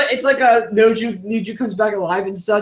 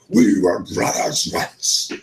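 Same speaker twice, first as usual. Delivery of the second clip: Megatron voice; 13 semitones lower, much slower than usual. Acted. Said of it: "the only line I can do for Megatron".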